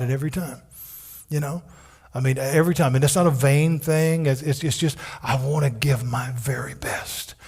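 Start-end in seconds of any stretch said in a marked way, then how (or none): 5.2-7.3 near-whisper, slow and soft; hushed confession. Real, not acted. Preacher confessing to the congregation, soft near-whisper. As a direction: breathe it out like a private confession. Confessing deepest wish quietly.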